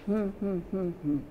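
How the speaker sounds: closed-mouth hums, one per syllable; duck talk hum. Shown as a demonstration. Teacher quizzing class on which word, hummed with mouth closed. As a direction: hum the word's syllables with lips sealed. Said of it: "say it with my mouth closed".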